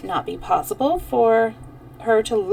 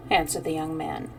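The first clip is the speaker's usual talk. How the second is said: ordinary delivery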